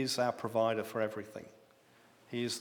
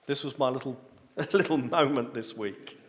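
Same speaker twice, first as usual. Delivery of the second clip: laughing; 3 dB louder than usual. Real, not acted. Laughs at his own discovery.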